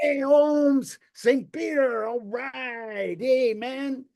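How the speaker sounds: high, casual homeboy greeting. Acted. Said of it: "And they say".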